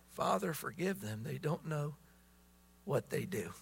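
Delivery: soft, hushed words of forgiveness; tender quote. Retold or imitated. Preacher quoting Jesus forgiving from the cross, hushed. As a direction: let it fall quietly like a dying man's prayer.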